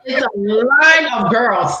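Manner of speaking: ordinary talk, nothing marked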